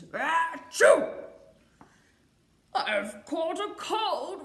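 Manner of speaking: loud sneeze, then a stuffy lion voice; sneezing lion. Acted. Lion sneezing and telling the doctors he has a cold. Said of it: "heard a lion sneeze".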